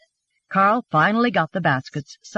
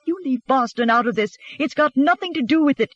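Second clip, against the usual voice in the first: indignant, higher-pitched protest; angry retort; 6 semitones higher than usual. A narrator's reading. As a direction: fire it back heatedly. Heated quarrel, Anna fires back.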